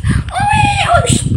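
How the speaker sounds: very high mock baby crying owe; baby wail. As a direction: wail like a newborn, high and thin. Baby cry then hush.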